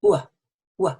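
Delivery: "u" turning very quickly into "uh"; w glide demo. Shown as a demonstration. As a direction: glide from "u" straight into "uh". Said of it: "very quickly turning it into an uh".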